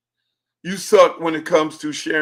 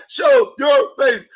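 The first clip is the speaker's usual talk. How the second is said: higher, louder, slow shouted demand; mock demand shout; 8 semitones higher, 7 dB louder, much slower than usual. Telling TikTok viewers to show their face, shouted. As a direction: bark it out, slow and loud. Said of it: "we be like".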